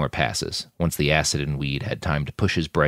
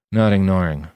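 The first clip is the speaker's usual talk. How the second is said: ordinary delivery, nothing marked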